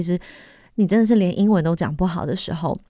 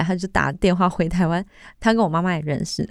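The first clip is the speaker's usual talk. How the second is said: ordinary delivery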